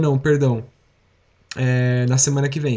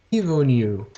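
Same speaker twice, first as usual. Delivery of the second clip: ordinary talk, nothing marked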